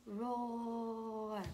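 soft, sustained piano roar; little roar. Shown as a demonstration. Teacher demonstrates the little dinosaur, soft roar. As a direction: roar small and softly. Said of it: "give it a little roar".

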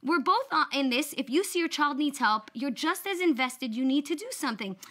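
wife lecturing husband on shared parenting; exasperated lecture. Pretend. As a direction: drive it home to him, mock outraged. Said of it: "I would have turned to my husband and said".

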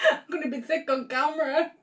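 laughing. Real, not acted. Laughing through pain, near tears.

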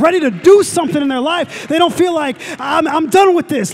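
high, loud call to get excited; high, loud preaching push; rallying preacher. Real, not acted. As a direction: fire up the congregation.

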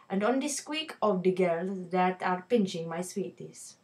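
reading the poem in a put-on Indian accent; lightly voiced Indian accent, storytelling the shopkeeper's day. Teacher reading the poem to her viewers in an Indian accent. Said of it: "I'm going to have to don an Indian accent".

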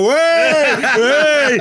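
high, loud jeering shouts; crowd jeering. Retold or imitated. Crowd cheering each punch on him, loud high jeering shout. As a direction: yell it like a rowdy crowd at every punch. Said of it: "they're all going".

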